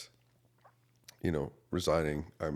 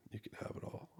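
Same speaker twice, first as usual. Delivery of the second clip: soft whisper of giving up control; whispered surrender; 15 dB quieter than usual. Retold or imitated. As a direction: breathe it out as a whisper. Surrendering to God in prayer.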